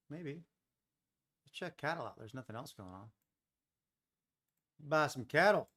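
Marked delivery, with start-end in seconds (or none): 4.8-5.7 put-on Texas accent. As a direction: play a Texas rancher calling out. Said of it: "that was my Texas accent".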